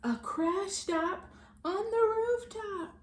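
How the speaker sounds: plain reading voice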